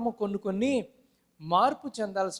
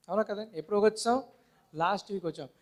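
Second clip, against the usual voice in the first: ordinary talk, nothing marked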